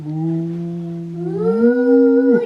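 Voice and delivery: normal talking voice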